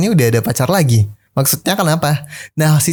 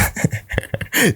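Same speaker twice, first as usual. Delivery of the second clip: soft breathy high laugh; 8 semitones higher, 7 dB quieter than usual. Real, not acted. Laughs at the breakup.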